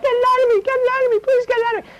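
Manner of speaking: put-on possessed man begging for deliverance in a small boy's voice; little boy crying out. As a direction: beg like a frightened little boy. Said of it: "a little boy's voice started crying out".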